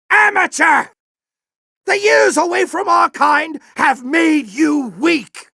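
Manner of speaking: taunting shout. Acted. General taunting Ratchet as a disappointing pupil.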